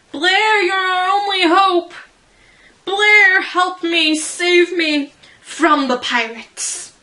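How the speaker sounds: high, loud, pleading cry; hammed-up loud plea for help; desperate plea. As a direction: beg Blair to save you, full melodrama. Pleading to Blair in a reading.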